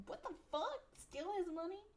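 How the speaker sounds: high, incredulous what the fuck; shocked outburst. Real, not acted. Player reacting to the mom's lines, stunned. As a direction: break off reading in disbelief.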